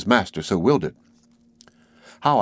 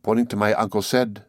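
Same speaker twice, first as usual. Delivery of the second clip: ordinary delivery, nothing marked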